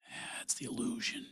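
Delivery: hushed whisper, no voice. Real, not acted. Comedian confiding to the audience in a whisper. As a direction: breathe it like a secret.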